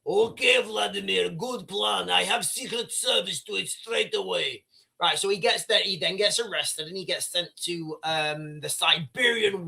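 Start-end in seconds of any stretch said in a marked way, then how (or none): 0.1-4.6 lightly voiced Russian-accent aide; mock Russian aide. Aide accepting Putin's order, in a mild Russian accent. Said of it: "He's like".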